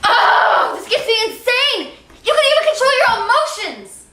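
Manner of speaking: high, exasperated shout; furious outburst. Acted. Wife losing patience with husband, furious outburst. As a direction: let the exasperation explode, pitched high.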